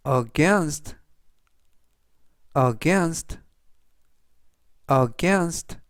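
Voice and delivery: careful, spaced-out vocabulary drill; slow model reading. Shown as a demonstration. Teacher reviewing words for learners to repeat, slow careful reading. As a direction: read it three times, leaving room to repeat. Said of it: "제가 천천히 세 번씩 읽어드리고요".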